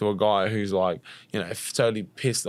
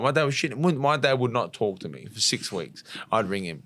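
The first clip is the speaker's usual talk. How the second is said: ordinary talk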